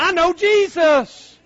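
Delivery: slow, high, loud shout; preaching shout of pride; proud shout. Real, not acted. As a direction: proclaim it to the whole church. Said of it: "preacher proclaims friend".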